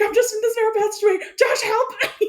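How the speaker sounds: high and fast, pleading for help; mock panic. Trapped player begging Josh for help, mock panic. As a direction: rush it out as a frightened plea. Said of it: "I'm like".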